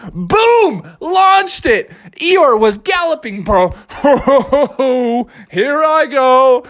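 mock sportscaster narrating the throw; excited play-by-play. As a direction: call the play like a hyped announcer.

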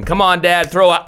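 retold ballgame encouragement, cut short; imitated cheering kid. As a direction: cheer on Dad like an eager son. Acts son cheering dad pitching.

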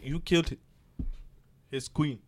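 put-on a rough, amateur stab at Grey Worm's accent; mock Unsullied threat, only lightly voiced; bad Grey Worm accent. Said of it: "that was a terrible Grey Worm accent".

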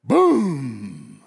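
loud, low blast of a word; explosive boom. Acted. Preacher breaks the hush after couple's prayer, explosive boom. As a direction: burst out suddenly and let it ring.

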